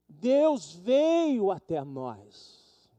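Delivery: very slow drawn-out Deus veio até nós. Acted. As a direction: hold every syllable. Repete a frase.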